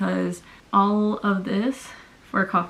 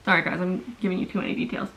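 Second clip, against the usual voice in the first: normal talking voice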